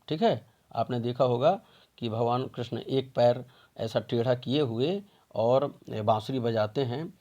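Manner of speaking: ordinary talk, nothing marked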